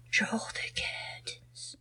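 very soft, slow whisper; imitated whisper. Acting out a remembered film line, slow whisper. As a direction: whisper it slowly, dying away.

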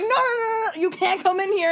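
high, loud panicked no no no; mock shout. Bouncers blocking her at the bathroom door, shouting. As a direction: yell them off from the door. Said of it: "And they're like".

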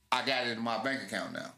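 normal talking voice

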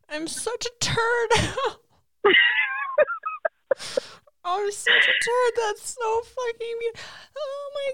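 really choked up, high-pitched crying; tearful. Real, not acted. Friend moved to tears by the proposal story, crying. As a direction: squeak the words out through real tears. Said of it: "tearing up this just because".

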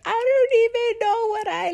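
high, fast, teary voice about to break; a breaking mock sob, rushed; retold crying. Telling her counselor how lost she felt, near tears. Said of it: "I was crying".

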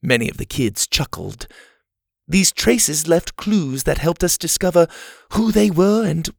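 lightly voiced genteel leader; camp leader Ma. A narrator's reading. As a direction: address the campers like a kindly matron. Ma's speech.

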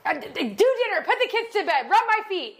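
high-pitched imitation of a demanding wife; mock demanding wife. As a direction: reel off chores as a mock-bossy wife. Voices wife listing husband's evening chores.

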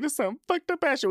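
very high mock-tearful squeal; crying lady. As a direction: quote the crying woman in a squeaky voice. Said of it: "whenever I'm texting Caesar, I'm like".

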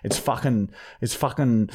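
ordinary talk

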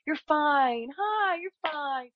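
put-on high, cooing mom hushing a crying child; soothing mom voice. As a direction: coo like a mother calming her toddler. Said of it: "the best mom in the whole world is like".

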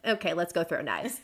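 bored low monotone quote; mock-bored sister voice; deadpan Mira. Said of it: "Mira's like".

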